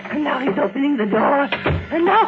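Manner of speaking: high, breathless, building dread; rising terror. Acted. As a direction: count his steps as he comes closer. Hears dead husband approaching.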